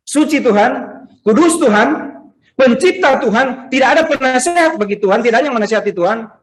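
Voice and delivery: high, ringing declaration; emphatic preaching. Real, not acted. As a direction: proclaim God's greatness point by point.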